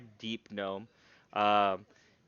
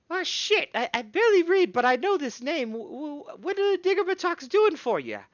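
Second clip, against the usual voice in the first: much higher, louder, surprised; startled gnome smith; 8 dB louder than usual. Acted. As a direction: blurt it out, shocked by the name. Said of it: "and she goes".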